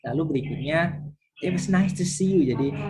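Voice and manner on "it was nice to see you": higher, louder cheery goodbye; bright farewell. Shown as a demonstration. Teacher modelling a friendly goodbye, higher and brighter.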